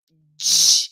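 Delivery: teacher demo; dj sound. Shown as a demonstration. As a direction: di said as dji, d blended into j. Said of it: "juntasse o sonzinho da letra D com o som da letra J".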